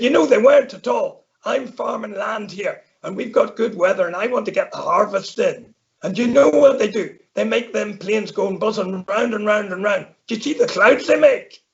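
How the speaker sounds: hammed-up Irish farmer grumbling about planes. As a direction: rattle on like an indignant Irish farmer. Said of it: "we can speak in an Irish accent".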